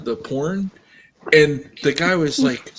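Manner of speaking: ordinary talk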